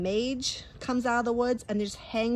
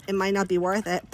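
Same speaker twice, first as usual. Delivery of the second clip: ordinary delivery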